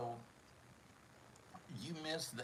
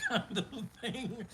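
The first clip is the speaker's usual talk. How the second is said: high, laughing words; 11 semitones higher than usual. Real, not acted. Host giving up on the inspirational theme, laughing. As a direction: squeak it out mid-laugh. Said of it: "Maybe it, maybe it is".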